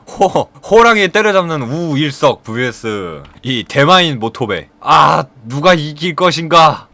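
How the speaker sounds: slow, drawn-out ring announcer build-up; fight announcer hype. Pretend. Narrator hyping the fight like a ring announcer. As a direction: pump up the crowd before a big match.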